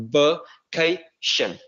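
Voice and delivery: "vacation" split slowly into "vuh, KAY, shun"; pouted ending sound. Shown as a demonstration. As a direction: pout the lips on the final "shun" of "vacation". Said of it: "chu miệng lên một chút".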